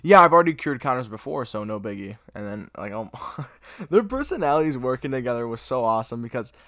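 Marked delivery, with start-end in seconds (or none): 0.0-2.2 cocky. Retold or imitated. Spider-Man bragging about curing Connors, cocky. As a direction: imitate a cocky hero brushing off his feat. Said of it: "being so cocky".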